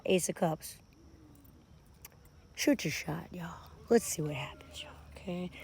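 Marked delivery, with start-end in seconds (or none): 3.9-5.5 very soft, low whisper; barely voiced aside. Real, not acted. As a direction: drop to a hush for the viewers. Hushed aside to viewers.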